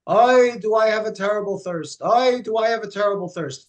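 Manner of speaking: put-on a whiny old man crying out for water; kvetching old man. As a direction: groan it like a parched old man. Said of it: "The old man keeps going".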